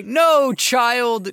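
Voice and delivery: high-pitched hammed-up warning; mock pleading protest. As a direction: feebly call back a child, theatrically. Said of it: "I'm just gonna say".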